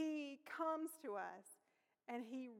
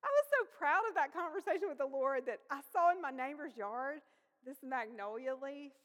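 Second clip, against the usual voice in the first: ordinary delivery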